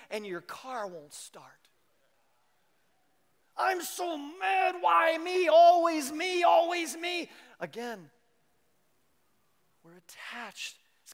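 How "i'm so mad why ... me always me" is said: mock tantrum. Mock rage, car won't start.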